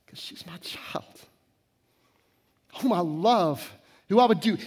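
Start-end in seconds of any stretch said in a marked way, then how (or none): 0.1-1.2 hushed whisper, barely voiced. Real, not acted. Father holding his crying daughter, whispered. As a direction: breathe it out like a secret.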